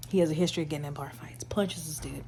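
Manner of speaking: fast gossipy whisper. Real, not acted. Reader whispering a plot detail like gossip. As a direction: dish it like a secret. Gossipy aside while retelling.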